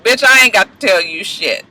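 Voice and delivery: ordinary delivery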